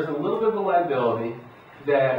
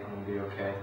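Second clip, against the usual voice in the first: the repeat said much softer, to yourself; softer self-reassurance; 4 semitones lower, 9 dB quieter, much faster than usual. Shown as a demonstration. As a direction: tell yourself quietly it will be fine. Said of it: "if all they do to be self-reassuring is".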